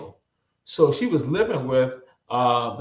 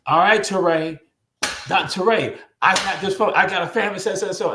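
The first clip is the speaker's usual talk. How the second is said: lightly acted urgent request; urgent calling out; 6 semitones higher than usual. Colleague calls on him to house a family, urgent calling out.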